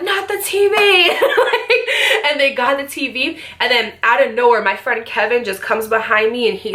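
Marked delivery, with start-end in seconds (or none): 0.0-0.7 high, panicked yelp of alarm; mock panic; panicked cry. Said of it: "And they're like". 0.7-2.3 high burst of laughter; real laugh. Real, not acted. Laughs at own story.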